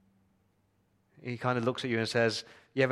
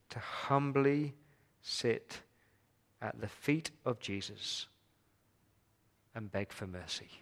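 slow, softer and softer to a near whisper; fading hushed plea; 6 dB quieter, slower than usual. Real, not acted. Preacher urging humility before Jesus, fading to a hush. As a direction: let it sink to a hush.

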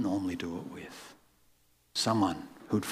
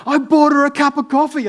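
much higher and louder, triumphant; excited; 15 dB louder than usual. Retold or imitated. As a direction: announce it, thrilled with herself. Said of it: "She was so excited".

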